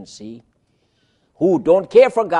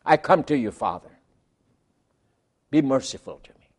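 humble prayer; 4 semitones lower than usual. Shown as a demonstration. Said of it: "but say, just say".

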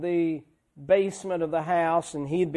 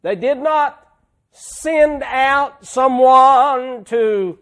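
louder, fervent pulpit preaching; preaching at full force; 5 semitones higher, 8 dB louder, slower than usual. Acted. Preacher thrilled about God's closeness, preaching hard. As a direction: drive the words home from the pulpit.

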